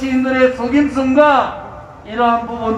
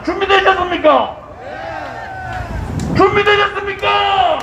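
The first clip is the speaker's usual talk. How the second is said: roaring rallying speech; 6 semitones higher than usual. Acted. Rally call to crowd.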